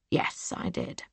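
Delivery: low, breathless whisper of a confession; a killer quietly owning his deed; cold whispered admission. Acted. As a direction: admit it in a dead whisper. Brother admits attempted murder.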